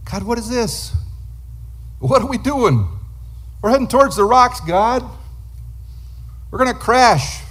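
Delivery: high, frantic plea to God; panicked prayer. Retold or imitated. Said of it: "temptation is for you to abandon God".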